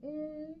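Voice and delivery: a long hummed mm; mm sound. Shown as a demonstration. Teacher modelling the M sound, a hummed mm. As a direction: hum the mm with closed lips. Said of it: "makes that mm sound".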